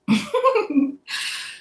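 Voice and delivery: high-pitched laugh; amused high giggle at your own story. Real, not acted.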